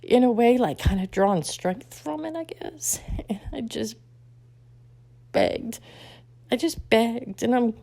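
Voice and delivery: real tears, high voice with halting pauses; choked up and high, breaking into whispers; crying. Real, not acted. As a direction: struggle to get the words out while crying. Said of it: "I'm crying right now".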